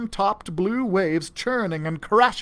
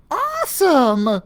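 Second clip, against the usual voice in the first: high, loud, slow squeaky cheer; thrilled squeaky cheer; excited squeak; 9 semitones higher, 6 dB louder, slower than usual. A narrator's reading. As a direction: squeak it out in delight. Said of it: "Susie squeaked in agreement".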